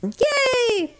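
high laughing squeal of joy; delighted cheer. Real, not acted. Host cheering winning the points, high and laughing. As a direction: cheer the mock prize. She won ten thousand points.

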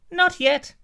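apple answers the giant in a very high squeak; talking apple. Acted. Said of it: "said the apple".

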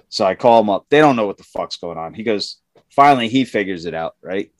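ordinary talk, nothing marked

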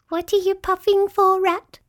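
very high little voice; small, high-pitched questioner; tiny voice. A narrator's reading. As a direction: ask as a tiny creature. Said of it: "said a tiny".

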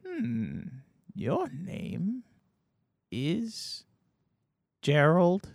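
put-on high, hushed, hesitant old man; soft doddering grandpa voice; ancient old man. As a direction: slowly get the name wrong. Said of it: "he goes".

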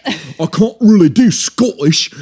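high, loud attempt at Scottish; badly faked Scottish accent, stretched out. Shown as a demonstration. Host showing his accents run wild, mock Scottish. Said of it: "if I'm going Scottish".

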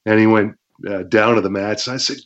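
ordinary talk, nothing marked

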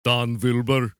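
attempted Russian that comes out German, V for W; loud mock-Russian slipping into German; botched Russian accent. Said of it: "No, that was German. The German does the V".

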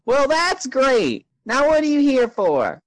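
very high falsetto desk receptionist; Karen. Acted. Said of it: "There is a human woman sitting at the desk".

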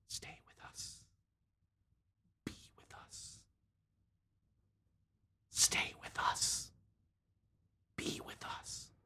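soft unvoiced whisper close to the ear; right in your ear. A narrator's reading. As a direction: breathe it right into their ear. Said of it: "they're whispering in your ear".

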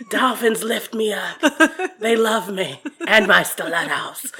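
lightly hammed-up boastful character; a touch of comic diva; Stangela on stage. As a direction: brag with mock grandeur, laughter underneath. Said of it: "got on stage as a character".

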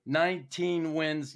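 normal talking voice

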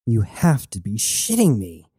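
ordinary delivery, nothing marked

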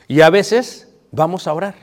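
ordinary delivery

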